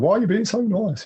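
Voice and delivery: high, tearful plea; upset, bewildered. Retold or imitated. Crying on the kitchen floor, asking his sister why she's being kind, high and tearful. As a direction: crack up into a pleading high voice.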